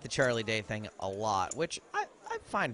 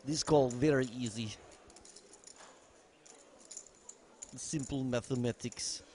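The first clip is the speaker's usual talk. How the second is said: put-on Russian accent, dropping little words; mock Russian commentator, matter-of-fact; 2 semitones higher than usual. Joking as a Russian commentator on an easy call, mock Russian accent.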